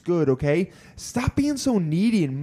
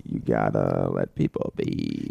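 much lower, slow and drawn out; deep slow voice; 3 dB quieter, slower than usual. Pretend. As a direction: drop way down and drag it. Slow low mock-solemn take.

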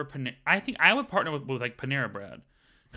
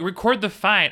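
mock user command; 7 semitones higher, 7 dB louder than usual.

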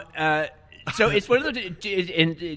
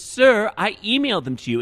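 secretary; 7 semitones higher, 4 dB louder than usual. Retold or imitated. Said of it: "she says".

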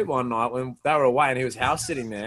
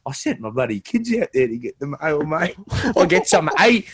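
mock outraged neighbour, rising to a higher, louder rant; angry neighbour; 4 semitones higher, 5 dB louder than usual. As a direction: imitate a fed-up Aussie neighbour complaining. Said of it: "one of the neighbours said".

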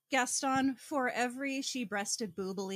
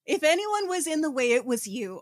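louder, higher triumphant comeback; mock indignant comeback; 4 semitones higher, 7 dB louder than usual. Co-host relishing Christine's put-down, louder and higher. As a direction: hit him with it, savouring every word.

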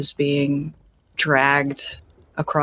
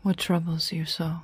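mysterious voice comforting a troubled captain; gentle ship spirit; 2 semitones higher, 7 dB quieter than usual. Acted. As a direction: coax the captain softly. NPC gently speaks.

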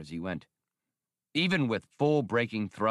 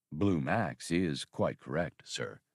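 slow, lower droid drawl; drawling labor droid; 6 semitones lower, 3 dB quieter, slower than usual. Acted. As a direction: let the droid drawl it out slowly. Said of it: "drawled the labor droid".

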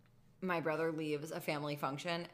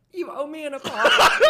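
high, loud whiny demand through laughter; mock tearful demand; 8 semitones higher, 15 dB louder than usual. Imagining brother's girlfriend demanding apology, whiny wail. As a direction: wail it like a sulky sister-in-law. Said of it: "crying in the corner being like".

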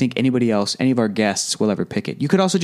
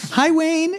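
high, put-on squeaky greeting; high mock voice greeting Wayne; Wayne's World quote. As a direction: pipe it up like a cartoon fan.

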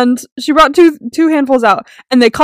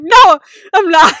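louder, drawn-out imitated crying; retold tearful wail; 5 semitones higher, 6 dB louder than usual. Upset worker answers if she's okay, retold tearful wail. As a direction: wail it higher, as if in tears. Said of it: "I started crying, and it was bad".

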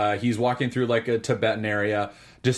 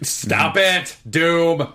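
high, louder mock protest; playful scolding; 7 semitones higher, 6 dB louder, much slower than usual. Real, not acted. Host to co-host mocking her long rundown, playful loud protest. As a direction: laughingly shut down the teasing.

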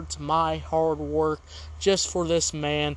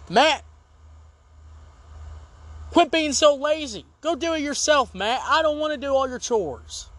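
mock angry yelling; 7 semitones higher than usual. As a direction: yell at your lazy friend. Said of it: "this is what I have to say".

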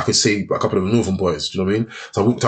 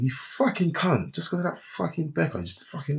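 seething inner voice; 6 semitones higher, 8 dB quieter than usual. Retold or imitated. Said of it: "I'm thinking".

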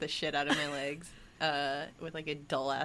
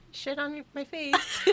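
mock ditzy quote; 11 semitones higher, 5 dB louder, slower than usual. Mock voice of Greek women.

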